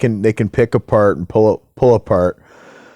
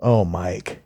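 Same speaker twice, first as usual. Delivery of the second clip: soft quoted name; 3 semitones lower, 6 dB quieter than usual. Retold or imitated. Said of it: "she goes".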